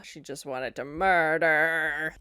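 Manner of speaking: low, drawn-out "murder"; slow it right down for a darkly comic punchline; mock menace. Viewer joking that she wanted murder, not cuddles, mock menace.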